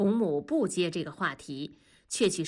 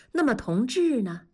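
normal talking voice

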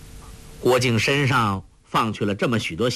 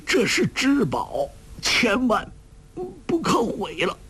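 urgent dying warning; 11 semitones higher than usual. A narrator's reading. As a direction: plead with what strength is left before fainting. Failing old man's urgent last warning.

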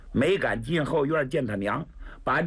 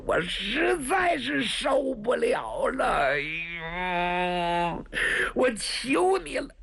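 pained begging; 4 semitones higher than usual. Acted. Wounded man pleading with 白公子 to end his pain, sobbing. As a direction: beg for death as if the pain is unbearable.

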